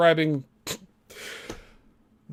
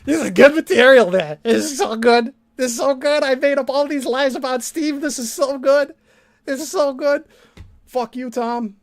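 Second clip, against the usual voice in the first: mock gloating; 8 semitones higher, 5 dB louder than usual.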